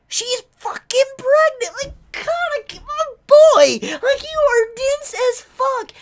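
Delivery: high, loud exasperated cry at a dense hero; rising to a high yelp of disbelief; exasperated outburst. Real, not acted. Exasperated rant at Kaneki.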